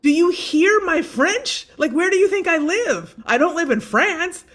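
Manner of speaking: high, loud and slow, incredulous; indignant protest. Retold or imitated. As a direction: push back hard, spelling out how absurd it is.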